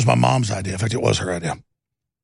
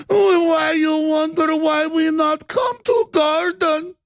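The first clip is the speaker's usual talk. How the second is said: high, slow, hammed-up fake Latin accent; mock Latin accent; 11 semitones higher, much slower than usual. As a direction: ham up a fake Latin American accent, crying out. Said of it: "puts in this fake Latin accent".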